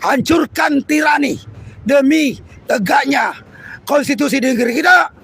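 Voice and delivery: loud, rousing protest oration; rally the workers against the regime; fiery revolutionary speech. Real, not acted. Protest speech at full force. (pidato revolusioner berapi-api)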